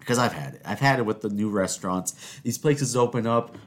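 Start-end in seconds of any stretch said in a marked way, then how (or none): none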